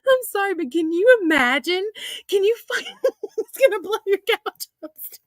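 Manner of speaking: high-pitched disbelief through laughter; laughing incredulously. Real, not acted. Woman picturing an exploding package, laughing incredulously. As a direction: barely get it out for laughing. Cracking up imagining the exploding blanket.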